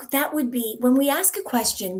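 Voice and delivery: normal talking voice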